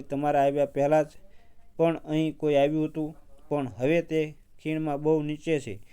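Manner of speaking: ordinary delivery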